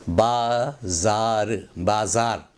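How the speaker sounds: बाज़ार drawn out slowly, syllable by syllable, with z; correct pronunciation. Shown as a demonstration. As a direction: say बाज़ार as "baa-zaar", buzzing the z. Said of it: "बाजार नहीं".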